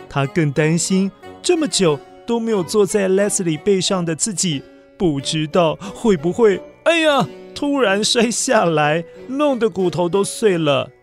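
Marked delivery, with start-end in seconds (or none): none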